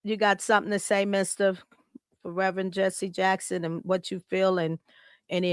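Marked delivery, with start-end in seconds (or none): none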